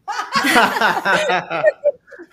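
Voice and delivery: a high, real burst of laughter. Real, not acted. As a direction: laugh out loud at your own joke. Laughs at own joke.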